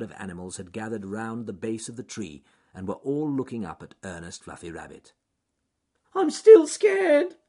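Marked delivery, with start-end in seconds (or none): 6.1-7.4 very high, loud, slow frightened shout; scared shout. Acted. Rabbit on the treetop calling down to the crowd, shouting. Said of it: "shouted Ernest Fluffy Rabbit".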